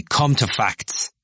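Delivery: slow, blunt and curt; blunt demand. Acted. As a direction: cut the talk short. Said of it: "said McCann bluntly".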